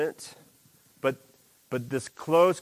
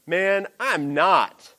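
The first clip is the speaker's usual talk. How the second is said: dismissive denial; 6 semitones higher, 7 dB louder, much slower than usual. Retold or imitated. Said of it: "Peter said".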